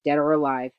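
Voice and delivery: mild roar of command. A narrator's reading. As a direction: order the crew to attack. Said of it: "Hook roared".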